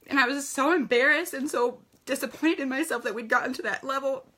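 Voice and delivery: choking up while admitting her embarrassment; tearful. Real, not acted. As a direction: voice thick with real tears, fighting to keep talking. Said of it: "Sorry, I'm crying".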